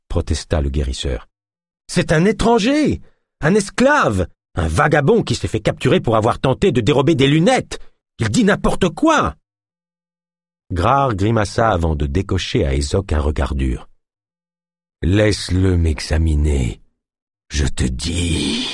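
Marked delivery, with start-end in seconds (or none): 1.9-9.4 high, contemptuous, slowing at end; scornful protest. A narrator's reading. 15.0-18.7 slow, low, fading into a groan; ending in a moan. A narrator's reading. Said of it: "s'acheva en une longue plainte".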